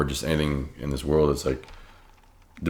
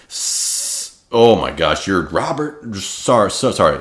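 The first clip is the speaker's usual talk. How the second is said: put-on higher, louder, tripping over the words like a starstruck kid; imitating a stuttering nervous kid; 4 semitones higher, 8 dB louder, much slower than usual. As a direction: play the flustered young reporter, lightly. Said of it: "He would keep on stuttering".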